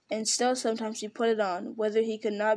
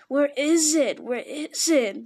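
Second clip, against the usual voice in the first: higher, louder wail, then slowed; frantic crying search; 4 semitones higher, 5 dB louder, slower than usual. A narrator's reading. Gollum searches his island for the lost ring, wailing. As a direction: wail it out in panic. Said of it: "heard him crying".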